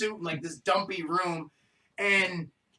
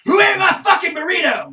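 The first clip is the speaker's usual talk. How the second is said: loud, high, demanding holler; angry yell; 8 semitones higher, 9 dB louder than usual. Retold or imitated. The couch dude hunting for his burrito, an angry yell. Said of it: "he's yelling".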